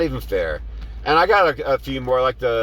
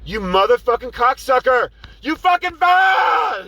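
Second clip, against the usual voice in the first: high, loud, slow cursing yell; retold outburst; 12 semitones higher, 4 dB louder, slower than usual. Driver cursing out the passenger, loud high yell. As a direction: unload on him at full volume. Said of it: "And I'm like".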